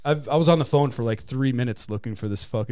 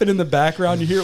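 ordinary talk

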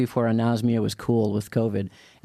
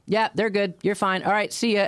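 breezy boat captain; 7 semitones higher than usual. Retold or imitated. Boat captain brushing off the worry.

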